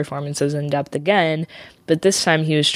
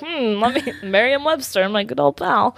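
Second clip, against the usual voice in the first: lightly higher imitation of Lenny; mock Lenny voice; 7 semitones higher than usual. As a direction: voice him a bit higher, playful. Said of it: "He's like".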